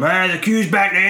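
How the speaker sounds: loud, high mock shout down the line; rude shout. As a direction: yell it like an annoyed stranger in a queue. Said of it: "Shouted something rude at him".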